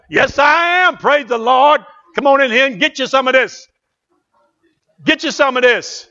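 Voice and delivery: high, loud preacher's whoop; drunk-on-the-Spirit shouting; exuberant shouted reply. Retold or imitated. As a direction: holler it out like a joyful invitation. Pastor's acted exuberant reply.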